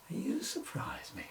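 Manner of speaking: dry, slow whispered aside; sarcastic whisper. Acted. Policeman whispering his sarcasm at the duck owner. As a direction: mutter the put-down under your breath.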